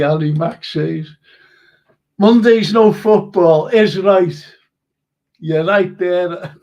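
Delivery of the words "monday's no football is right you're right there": drawn-out, loud approval; slow loud emphatic. Real, not acted. Host loudly agreeing with a fan comment, slow and emphatic. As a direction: lean on each word, slow and loud.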